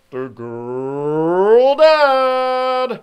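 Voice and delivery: slow, loud drawn-out announcement; drawn-out announcer boom. Acted. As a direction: stretch it out like a game show host. Dramatic awards announcement.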